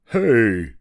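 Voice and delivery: calling out. A narrator's reading. Moose to his guests upstairs, calling out. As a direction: holler up to someone above. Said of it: "he called out".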